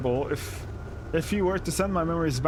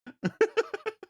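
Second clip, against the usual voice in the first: laugh. Real, not acted. Laughing at the video caption.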